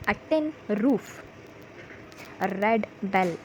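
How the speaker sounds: slow, measured phrases with pauses between; phrase drill for repeating. Shown as a demonstration. Teacher has pupils repeat short phrases, slow drill delivery. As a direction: space the words out for learners to echo.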